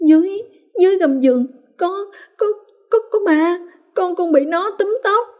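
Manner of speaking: put-on very high, stammering, frightened child; trembling voice. A narrator's reading. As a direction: stutter it out, terrified. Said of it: "giọng run run". (con Hoa run run kể về con ma)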